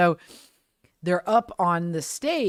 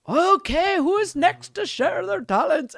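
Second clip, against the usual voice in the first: much higher, singsong stage announcer; hammed-up crowd call; pirate emcee; 13 semitones higher, 5 dB louder, slower than usual. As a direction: imitate a pirate host calling for volunteers. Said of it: "the guy again is like".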